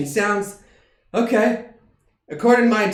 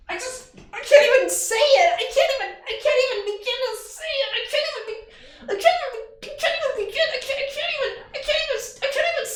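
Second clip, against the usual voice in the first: overwhelmed squeal; faster than usual. Acted. Overwhelmed character can't say it.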